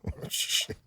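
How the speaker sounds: hushed, breathy under the breath; whispered oh shit. Retold or imitated. Whispering in disbelief at the stapled husband's answer.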